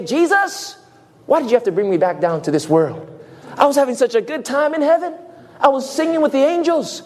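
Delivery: high, quick, mock-complaining; hammed-up pleading to go back; whining Lazarus. Lazarus parodied complaining to Jesus, high and fast. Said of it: "he began to say".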